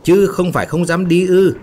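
high, loud taunting challenge; sharp retort. A narrator's reading. Woman worker taunts Dũng for not daring, sharp retort. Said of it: "Một chị đốp chát nói".

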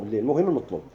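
normal talking voice

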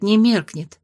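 ordinary delivery

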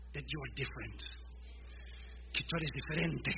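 normal talking voice

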